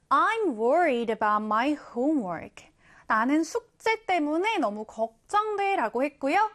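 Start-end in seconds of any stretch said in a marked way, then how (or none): 0.1-2.7 I'm worried about my homework, read very slowly; slow example read. Shown as a demonstration. Teacher reading the example sentence slowly. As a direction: give each word its own space. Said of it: "예문을 한번 볼게요".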